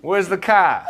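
loud, high Boston shout; hammed-up Boston holler; brash Boston accent. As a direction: demand it in broad Boston, r's dropped. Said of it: "I don't even know if it's Boston".

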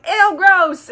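loud, high mock disgust; Memnoch. Demon grossed out by the eyeball, mock disgusted. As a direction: recoil with an exaggerated squeamish cry. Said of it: "confusion of Memnoch going".

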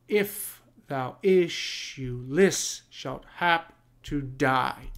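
slow rhythmic scansion; scanned iambic beat. Shown as a demonstration. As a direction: drag it out to show the beat. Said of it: "So this is all iambic pentameter".

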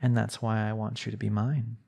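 ordinary talk, nothing marked

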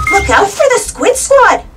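loud, high warning yell. A narrator's reading. Boy warns his friend during the computer game, yelling. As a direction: yell out an urgent game alert. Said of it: "yelled Buster".

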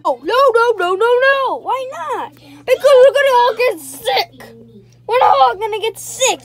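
high loud panicked no; panicked refusal. Acted. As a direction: refuse in a frantic rush.

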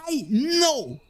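high, drawn-out comic exasperation; put-on fed-up reply; mock exasperation. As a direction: stretch each "know" up high, hammed-up. Mock exasperation at vendor's repeated greeting.

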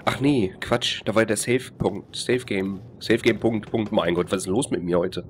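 normal talking voice

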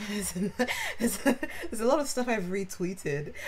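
words broken up by giggles; laughing while talking. Real, not acted. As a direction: stumble through it, restarting between laughs. Laughs at own search results.

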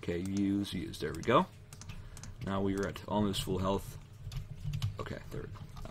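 ordinary delivery

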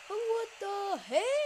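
slow, drawn-out high disbelief; surprised. Real, not acted. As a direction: stretch it out in disbelief. Surprise at game event.

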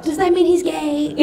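high, mock-worried question; mock letter writer. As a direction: ask it as a naive letter writer. Reads out her childhood prank letters.

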